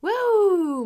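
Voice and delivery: high, loud wow; amazed cheer. A narrator's reading. Children watching teacher's jump, amazed cheer. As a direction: let out an admiring whoop.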